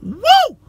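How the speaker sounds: high, loud "woo" scream; reenacted scream. Preacher reenacting his overwhelmed outburst in church, high loud scream. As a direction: let out a sudden piercing whoop. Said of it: "I let out this scream".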